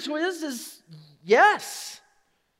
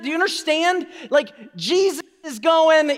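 ordinary delivery

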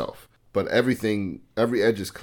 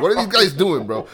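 exasperated; 10 semitones higher, 6 dB louder than usual. Retold or imitated. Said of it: "I'm like".

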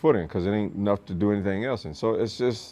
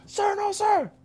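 recruit answering; slower than usual. Retold or imitated. Recruit answering drill instructor.

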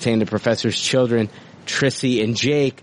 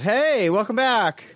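high, hammed-up show welcome; mock-peppy greeting; 10 semitones higher than usual. Host welcoming listeners back to a clusterfuck episode, mock cheer. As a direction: fake the big upbeat host hello.

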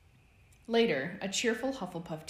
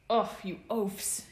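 slow, raised grumble; complaining; 5 semitones higher, 5 dB louder, slower than usual. A narrator's reading. Remus tackled to the ground, complaining. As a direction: groan it out in annoyance. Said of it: "he complained".

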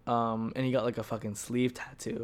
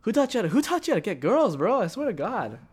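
high, loud mock outrage; exasperated scolding; 9 semitones higher, 4 dB louder than usual. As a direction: act out dressing down a clueless friend. Retold scolding of the bad flirt.

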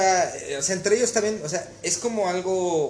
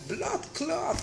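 imitating a Jamaican cook, high and softer; cook dying of laughter; 11 semitones higher, 7 dB quieter than usual. Cook cracking up in the kitchen, high imitated squeal. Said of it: "se estaba muriendo de risa".

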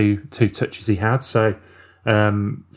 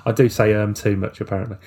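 ordinary delivery

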